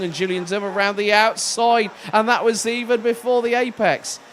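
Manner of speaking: rising, loud race call; excited. Real, not acted. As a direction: call the overtake with mounting thrill. Overtake around the outside.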